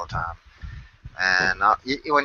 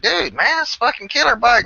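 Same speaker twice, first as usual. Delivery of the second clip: higher, pumped-up praise; hyped biker; 10 semitones higher than usual. Retold or imitated. Complimenting a new biker buddy's bike, higher and excited. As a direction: gush over the bike. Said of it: "and I'm like".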